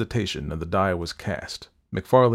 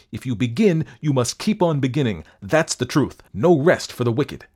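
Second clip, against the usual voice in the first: raised-pitch, insistent arguing; heated retort; 5 semitones higher, 4 dB louder than usual. A narrator's reading. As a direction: press the argument on an unwilling accomplice. Wolfe argues back after crying out.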